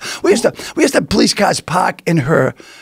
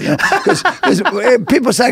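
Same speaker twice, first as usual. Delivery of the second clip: normal talking voice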